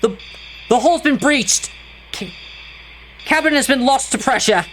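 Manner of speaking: panicked mayday. Acted. Pilot's distress call from crashing bullhead.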